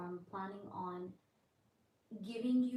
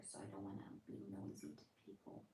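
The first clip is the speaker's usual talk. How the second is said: hushed, softer and dropping lower; 12 semitones lower, 10 dB quieter, faster than usual. Real, not acted. Tarot reader to viewers at midnight, not disturbing people, lowered voice. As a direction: keep it down so nobody nearby hears. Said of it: "I'm gonna lower my voice".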